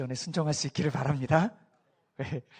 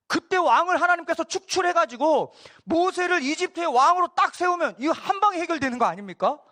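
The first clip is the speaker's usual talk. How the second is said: ordinary talk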